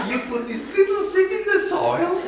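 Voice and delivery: high, condescending mock voice talking down; mocking the patronizing know-it-all. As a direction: explain it like to a small child, laughing. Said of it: "we are so patronizing".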